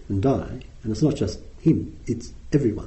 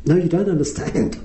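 higher, pleading repeat of the line; exasperated young man; 5 semitones higher than usual. Retold or imitated. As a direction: push it up, frustrated he isn't heard.

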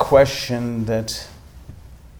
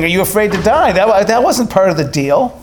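high, loud, rushed retelling of his startled objection; flustered protest; 9 semitones higher, 7 dB louder than usual. Retold or imitated. To the old monk who asked about death, a high flustered protest. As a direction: sputter back, caught off guard. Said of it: "you could tell I was kind of upset".

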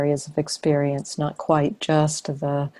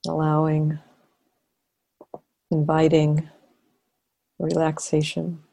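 guided meditation. Real, not acted. Teacher leading a sitting to relax and notice awareness, guided meditation. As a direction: invite them gently into awareness, phrase by phrase.

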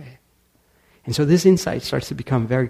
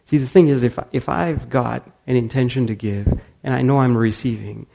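ordinary delivery

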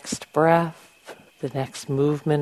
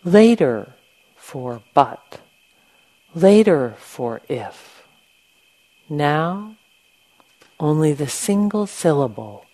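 measured poem reading with firm, louder openings to phrases; 5 dB louder than usual. Real, not acted. Meditation teacher reading a short poem to the group, poem reading. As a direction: recite in short weighted phrases, pausing between them. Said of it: "I want to read you a short poem".

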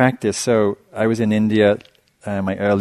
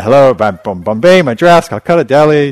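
loud, higher blaring hello through a speaker; loudspeaker announcement; 5 semitones higher, 11 dB louder than usual. Retold or imitated. Teacher mimicking the bus ticket loudspeaker, loud blaring call. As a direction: boom it out like a cheap roadside loudspeaker.

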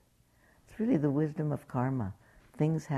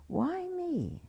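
anguished why me. Retold or imitated. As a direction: cry it out like someone pleading with fate. Martha despairing over her cancer.

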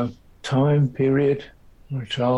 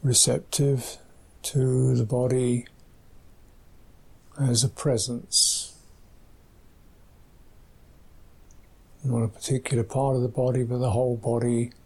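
soft, hushed meditation guidance with long pauses; guided meditation; 5 dB quieter than usual. Acted. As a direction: guide them gently into the body, phrase by phrase, leaving space. Leads the silent meditation practice.

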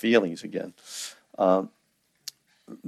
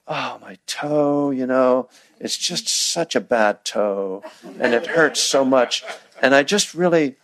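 mock self-pity; 3 semitones higher than usual. Teacher parodying someone dwelling on a stubbed toe, mock-woeful. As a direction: play up the woe over a tiny hurt.

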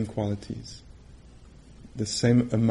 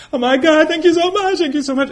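much higher and louder, overwhelmed thanks; hammed-up overwhelmed retreat student; gushing gratitude; 9 dB louder, faster than usual. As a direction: pour out the thanks in a high, loud gush. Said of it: "once in a while, somebody".

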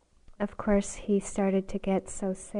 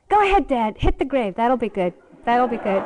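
taunting; 2 semitones higher, 7 dB louder than usual. Retold or imitated. Little kid egging on an angry father, taunting. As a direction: goad Dad like a cheeky kid.

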